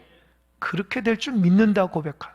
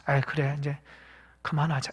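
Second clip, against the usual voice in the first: resigned sigh; 6 semitones lower, 12 dB quieter than usual. Acted. Faithless Nehemiah giving up.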